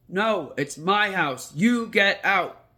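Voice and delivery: the ghost pushes back hard; ghost's loud refusal. Retold or imitated. Ghost refusing to leave the boy, slow loud defiance.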